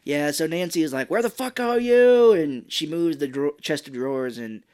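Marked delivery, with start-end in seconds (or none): 1.1-2.3 high, very loud mock-furious holler; furious yell. Nancy hunting for vanished Sarah, furious mock yell.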